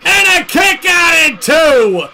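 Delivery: live play-by-play, rising to shouts; wrestling commentator. Acted. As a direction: call the match like ringside commentary.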